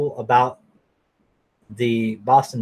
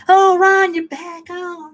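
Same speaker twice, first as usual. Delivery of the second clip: high, falsely delighted greeting; fake welcome; 4 dB louder, slower than usual. Mocking people welcoming Ron back, high fake delight. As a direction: gush like a phony friend, very high.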